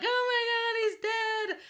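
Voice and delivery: high, loud mock wail; hammed-up drunken sobbing despair; drunk crying. Daughter left crying in the car, mock drunken wail. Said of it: "I'm, like, crying in the car, drunk".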